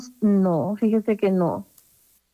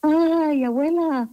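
imitating a scared child calling out, higher and drawn out; mild mock yell for grandma, pitched up; frightened grandson's cry; 6 semitones higher, slower than usual. Grandmother quoting her frightened little grandson, mild imitated cry. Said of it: "con cualquier cosa está gritando".

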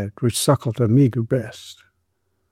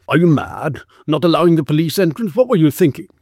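angry scolding; 5 semitones higher than usual. Acted. Policeman angrily scolds her.

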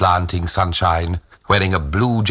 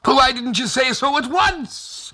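very high, excited scolding; relieved exclamation. A narrator's reading. Old Tag learns they are friends, excited high exclamation. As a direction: burst out in relief.

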